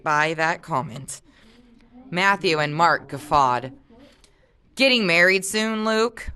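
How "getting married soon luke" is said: teasing. A narrator's reading. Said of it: "Mark teased".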